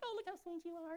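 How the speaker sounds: high, sugary condescension; mock-sweet, high-pitched patronizing praise. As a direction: coo down at a small child. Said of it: "in a patronizing way".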